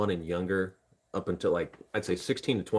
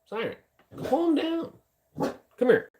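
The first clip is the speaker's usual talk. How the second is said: much higher, coaxing voice to the dog; high pet voice; 4 dB louder, slower than usual. Real, not acted. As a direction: coo at the dog to settle her down. Calming the dog Siren.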